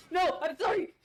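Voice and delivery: high, loud frantic pleading; real fright, yelping sorry; panicked apology. Real, not acted. Gamer under attack pleading with the enemy, panicked.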